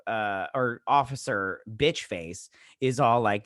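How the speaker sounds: normal talking voice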